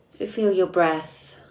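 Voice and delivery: soft, unhurried meditation voice with long pauses; guided meditation. Acted. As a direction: guide listeners inward gently, leaving space between phrases.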